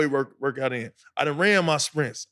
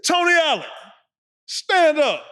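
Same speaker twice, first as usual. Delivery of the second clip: higher, louder coach calling him out by name; imitating the coach; 10 semitones higher, 3 dB louder than usual. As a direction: call the player up like a stern coach in film session. Coach calls player out.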